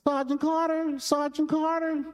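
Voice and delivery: put-on a little boy calling for help from a locker; child hollering. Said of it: "He started hollering".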